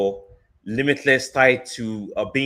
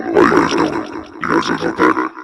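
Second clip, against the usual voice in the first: very deep, louder voice changer; toy echo microphone; 13 semitones lower, 4 dB louder than usual. Real, not acted. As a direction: toy mic pitched way down. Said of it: "it makes my voice deep".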